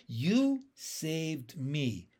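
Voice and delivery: slow, hushed wonder; slow realisation. Acted. Young frog finally realising Pin saved him, slow and wondering. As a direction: let it dawn on you slowly. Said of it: "I said at last".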